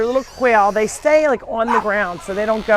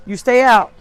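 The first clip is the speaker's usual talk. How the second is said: louder, firm order with falling end; firm command; 4 semitones higher, 10 dB louder than usual. Real, not acted. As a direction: order an animal to stay out. Orders an animal to stay out.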